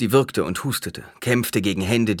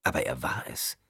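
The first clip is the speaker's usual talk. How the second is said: ordinary delivery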